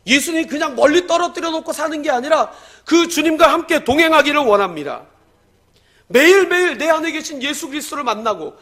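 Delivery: loud, high impassioned blessing over the church; full-force preaching. Acted. Pastor blessing his congregation, loud impassioned preaching.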